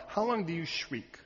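usual speaking voice

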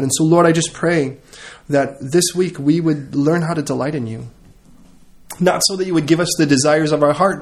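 loud, impassioned pastor's prayer; fervent prayer. Acted. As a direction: pour it out to God from the pulpit. Pastor leads closing prayer.

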